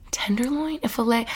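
ordinary delivery

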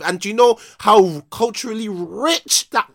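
real, high, loud impassioned exclaiming; emotional outburst. Real, not acted. Host still upset over [name]'s Grammy loss, emotional outburst. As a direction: build to an exasperated cry. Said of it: "I'm still getting emotional about it again".

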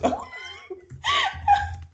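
high shrieking laugh; a real high laugh bursting out; burst of laughter. Real, not acted. Laughs at missing the moment.

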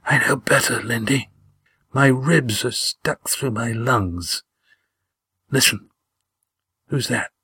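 halting voice of a badly hurt man; weak, slow whispering. A narrator's reading. Wounded Gar sending Damon away, weakly whispered. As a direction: labour it out slowly but coherently. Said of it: "as he got enough control to whisper".

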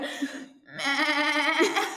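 high laughing sheep bleat. Pretend. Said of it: "let me try again".

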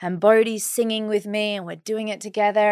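normal talking voice

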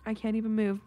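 usual speaking voice

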